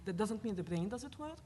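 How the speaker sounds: ordinary delivery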